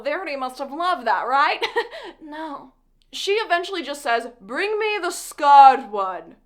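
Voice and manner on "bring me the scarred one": slow, loud, menacing villain decree; louder, slow supervillain command. Acted. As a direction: hand down the order like a Batman villain. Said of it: "your whole Batman villain persona".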